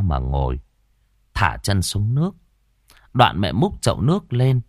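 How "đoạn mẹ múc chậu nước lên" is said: ordinary delivery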